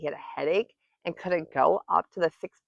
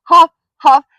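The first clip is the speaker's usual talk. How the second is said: high, loud, words tumbling into muddle; panicked crying out; 7 semitones higher, 12 dB louder than usual. A narrator's reading. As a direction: shriek so frantically that the words get jumbled. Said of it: "still crying out".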